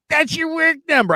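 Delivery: high-pitched, pushy caller impression; Ralph. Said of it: "And he's like".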